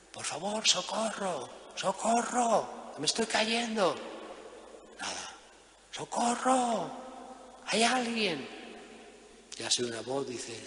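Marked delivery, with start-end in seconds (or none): 0.1-3.9 mock cry for help. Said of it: "comenzó a gritar". 5.9-8.5 high, held-back call into the distance; mock cry for help. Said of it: "comenzó a gritar".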